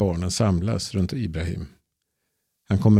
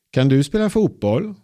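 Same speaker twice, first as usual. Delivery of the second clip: put-on high, bright child's voice asking; a small girl curiously asking the grown-up; little girl; 7 semitones higher, 4 dB louder than usual. A narrator's reading. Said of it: "Frågar en liten rödhårig tjej". (liten flickas röst, ljus och nyfiken)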